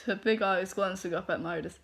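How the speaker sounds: ordinary delivery, nothing marked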